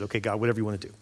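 low, quiet giving in; resigned acceptance. Retold or imitated. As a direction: surrender softly to God, voice dropping. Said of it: "He just admits it".

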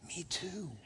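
quiet, secretive aside; hushed confession. Retold or imitated. A friend quietly admits the same struggle, softly. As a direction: admit it under your breath. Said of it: "He's gonna go".

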